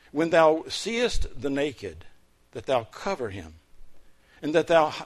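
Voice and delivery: solemn, declaiming King James reading; scripture reading. Acted. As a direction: proclaim the verses to the church. Reads Isaiah 58 aloud.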